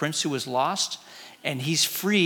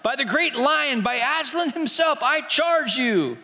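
rising higher and higher, begging; frantic prince pleading for release, higher on each line; desperate plea; 7 semitones higher than usual. Acted. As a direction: beg them to cut you free, building to the top. Prisoner begging to be freed.

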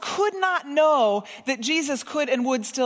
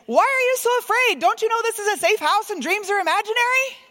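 much higher, louder scolding; mother scolding her daughter after a nightmare, harsh; harsh parent; 10 semitones higher, 6 dB louder than usual. Pretend. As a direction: snap at the scared child. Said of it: "No loving parent does that".

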